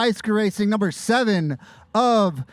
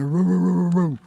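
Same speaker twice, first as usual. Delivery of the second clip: mock barking roo roo; lightly voiced, hammed-up woofs; playful dog bark; 2 semitones lower than usual. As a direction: bark like a goofy police dog. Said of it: "I'm a police dog".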